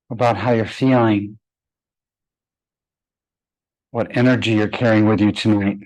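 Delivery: unhurried, even meditation voice with long pauses; guided meditation. Acted. Yoga teacher guiding her class to close their eyes, calm meditation voice. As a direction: ease the class inward, one phrase at a time.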